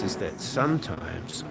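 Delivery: ordinary talk, nothing marked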